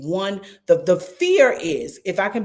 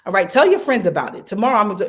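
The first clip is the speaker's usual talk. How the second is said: ordinary talk, nothing marked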